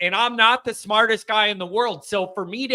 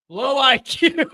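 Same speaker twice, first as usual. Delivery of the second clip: slow, high words breaking into laughter; real laughing; 6 semitones higher, slower than usual. Real, not acted. Host amused by a jab from chat, laughing.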